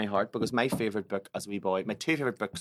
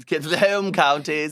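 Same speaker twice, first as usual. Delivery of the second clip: put-on posh accent; 6 semitones higher, 8 dB louder, slower than usual. Posh voice continues.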